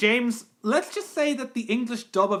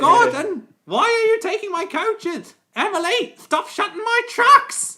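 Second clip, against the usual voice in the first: loud, very high indignant engine; Thomas; 14 semitones higher, 9 dB louder than usual. Acted. Engine yelling at Gordon and Emily, in a high Thomas shout. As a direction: shout the accusation in a squeal, slowing at the end. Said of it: "out of Thomas' voice though".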